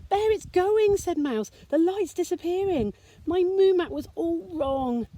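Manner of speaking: high, worried little voice; fretting as the light fades; distressed little mouse. Acted. Mouse frets to Bear as the moon goes, high and worried.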